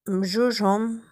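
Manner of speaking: ordinary talk, nothing marked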